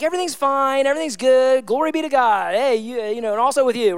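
overly upbeat church greeting voice; fake cheerful churchgoer. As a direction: put on a brave, chirpy everything's-fine act. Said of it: "put on a mask to be like".

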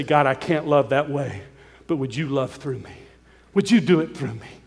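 soft, low, hushed plea to God; humble prayer. Pretend. Men kneeling to ask God for help, in a soft, low prayer. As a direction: pray it quietly, on your knees. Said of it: "get on our knees and say".

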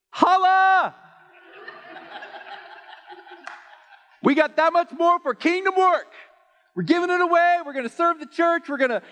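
high, loud joyful shout; excited cheer. Retold or imitated. Husband cheering his wife's bigger paycheck, high and loud. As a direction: celebrate it like a win.

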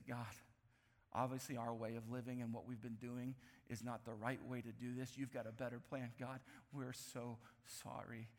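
low, hushed plea, slowing on the apology; contrite prayer. Acted. Israelites admit to God their way failed, low and contrite. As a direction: apologize softly and humbly to God.

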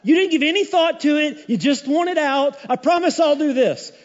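high, loud, hasty promise to God; mock rash vower. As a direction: blurt the vow without a thought. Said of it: "you've said".

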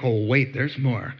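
ordinary delivery

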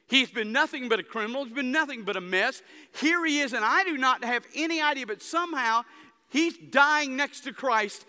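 impassioned revival preacher; full-force preaching. Acted. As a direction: preach it out with mounting fire.

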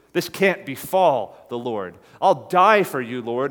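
slow, then loud passionate pledge; earnest mock vow of loyalty; Peter's vow. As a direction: plead it like Peter in the garden.

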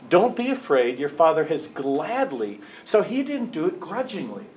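ordinary talk

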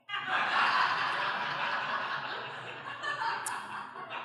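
real breathy laughter; high laugh. Real, not acted. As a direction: laugh freely at the joke. Preacher laughs at joke.

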